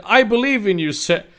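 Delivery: high, loud story dialogue; acted encouragement. First take, before switching to character.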